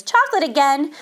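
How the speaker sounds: mother tired of hearing this; mildly dismayed, disbelieving query; protesting. A narrator's reading. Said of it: "protested Missus Midas".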